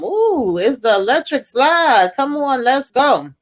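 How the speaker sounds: high-pitched, hammed-up party squeal; put-on, high whoop of excitement; mock excited. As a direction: imitate someone hyped to hit the dance floor. Mocking a party guest calling dancers.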